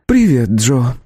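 flat, indifferent greeting; unemotional. A narrator's reading. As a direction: greet him without interest. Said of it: "без особых эмоций ответила".

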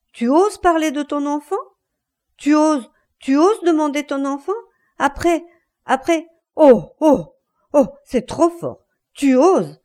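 high, loud incredulous fury; outraged shouting. Acted. Husband outraged she dares claim the child, shouting. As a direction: explode in indignation, broken by gasped exclamations.

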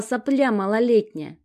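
heated outburst. Acted. Sasha flares up at her sister, heated and loud. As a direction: spit the insult at the little sister. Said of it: "распаляется Сашка".

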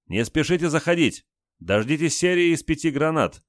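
high shouted instructions; shouting. Acted. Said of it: "крикнул он".

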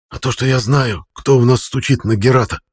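plain reading voice

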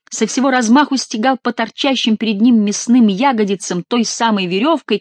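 ordinary delivery, nothing marked

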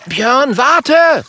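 high, loud shout; high-pitched alarmed holler; urgent call. A narrator's reading. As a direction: yell after someone leaving. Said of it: "ruft Kokosnuss".

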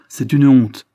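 growing indignation. A narrator's reading. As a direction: let the outrage rise. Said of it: "en s'animant de plus en plus".

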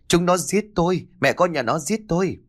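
high, alarmed wailing; panicked roar. A narrator's reading. Daughter-in-law being beaten by brother-in-law, high panicked cries. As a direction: wail for help at a high pitch. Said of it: "Linh hoàng quá lúc này liền gầm lên".

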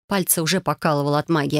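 ordinary delivery, nothing marked

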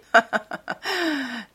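high, falling derisive laugh; mocking laugh. A narrator's reading. Dorian laughing at the shocked painter, high and derisive. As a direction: laugh scornfully at him. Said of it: "Il rit de nouveau".

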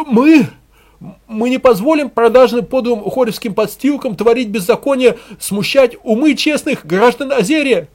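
high, loud demagogue's harangue; hysterical wailing cry. A narrator's reading. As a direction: wail it to the crowd like a fanatic. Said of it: "кликушески возопил пропагандист".

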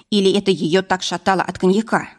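ordinary delivery

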